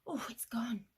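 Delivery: usual speaking voice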